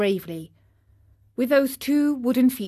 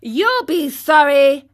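loud, slow, higher-pitched threat; threatening; 6 semitones higher, 8 dB louder than usual. Acted. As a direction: warn him with drawn-out menace. Boys threaten the puppet.

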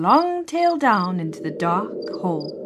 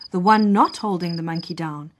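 ordinary delivery

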